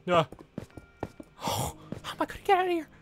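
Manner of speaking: high, fast, whispered frightened cry; breathless panic. Real, not acted. Player trapped by lava asks how to escape, breathless fear. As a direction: gasp it out, trapped and scared.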